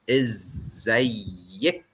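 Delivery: "إزيك" said slowly as izzayyik, ending in ik; "إزيك" drawn out, feminine ik ending; Egyptian Arabic. Shown as a demonstration. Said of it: "you say".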